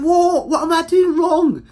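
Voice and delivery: high, loud protesting voice; mock innocent girlfriend. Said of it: "she said".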